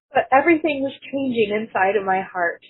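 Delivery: normal talking voice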